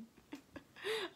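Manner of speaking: high-pitched burst of giggling; real high laugh. Real, not acted. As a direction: laugh out loud at your own story. Laughs at her own confession.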